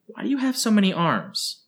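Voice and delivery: put-on high, quick childlike chatter; lightly voiced eager child; curious girl rattling off answers; little girl.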